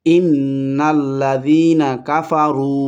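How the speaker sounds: slow recitation, রু of কাফারু held briefly; one-alif stretch. Shown as a demonstration. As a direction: lengthen the last vowel of কাফারু by one count. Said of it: "এখানে একালি পরিমাণ টান হবে". (কাফারু-র রু এক আলিফ টেনে পড়া)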